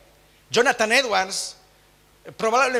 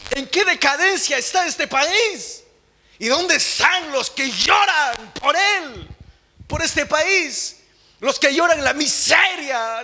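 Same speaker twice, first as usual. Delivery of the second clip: slow, raised and higher pleading sermon cry; impassioned preaching; 7 semitones higher, 3 dB louder, slower than usual. Acted. Preacher pleading with the congregation over the country, impassioned slow cry. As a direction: call out to the brothers, lamenting the country.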